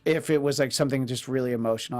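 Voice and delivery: ordinary talk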